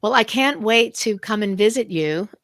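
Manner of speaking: usual speaking voice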